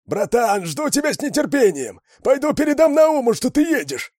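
raspy radio voice. A narrator's reading. Kruger answers over the radio, loud and hoarse. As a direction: crackle it like a hoarse con on the radio. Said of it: "Рация ещё похрипела".

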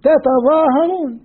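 تتظاهرون stretched slowly with both taa sounds; slow recitation. Shown as a demonstration. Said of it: "أنتم إيش بالمضارع".

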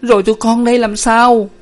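very high, loud tearful wail; crying while speaking. A narrator's reading. Daughter to despairing father, crying as she speaks. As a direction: wail the question at him. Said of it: "Nguyệt vừa khóc vừa nói".

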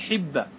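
حبة said "hibba", with i after the h; kasra vowel. Shown as a demonstration. Teacher showing students a verb form, حبة with kasra, "hibba". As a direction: put a short i in حبة: "hibba". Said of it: "حبة بالكسر".

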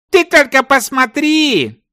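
very high, drawn-out amazed cry; astonished call. A narrator's reading. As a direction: call your partner over in amazement. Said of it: "Торговец казался удивлённым". (изумлённый возглас)

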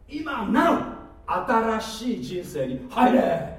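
loud, slow proclamation; rousing exhortation. Real, not acted. Preacher urging people into a new life, rousing. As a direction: call it out, stretching each phrase.

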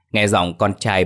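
ordinary delivery, nothing marked

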